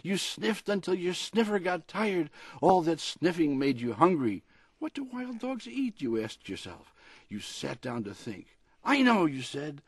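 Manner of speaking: lively picture-book read-aloud; storybook reading. A narrator's reading. Author reading his dog story on the radio, storybook voice. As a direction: read it like a bedtime story for a pet.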